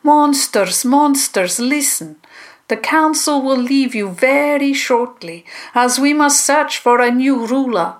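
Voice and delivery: high, projected character voice addressing a crowd; commanding monster councillor's announcement; loud public address. A narrator's reading. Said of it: "stepped forward again and spoke loudly".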